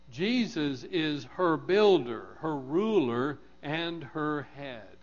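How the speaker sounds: slow, weighty list of titles; measured, emphatic preaching; emphatic proclamation. Real, not acted. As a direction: land firmly on each title, then ease down.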